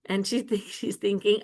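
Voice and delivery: ordinary delivery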